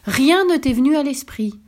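indignant exclamation. A narrator's reading. Wife scolds him for wishing nothing, indignant exclamation. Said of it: "s'écria-t-elle".

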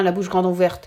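usual speaking voice

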